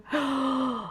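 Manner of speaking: sharp gasp of dismay; shocked gasp. Real, not acted. Gasping on spotting pests on her plant. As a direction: catch your breath at bad news. Discovers spider mites on plant.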